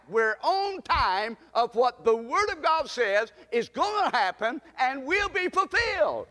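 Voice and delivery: fervent, emphatic preacher; preaching at full force. Real, not acted. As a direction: proclaim it with rising conviction. Preaches on where nation stands.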